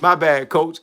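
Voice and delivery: ordinary talk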